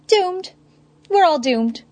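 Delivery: high despairing wail. Acted. Nigma wails doom.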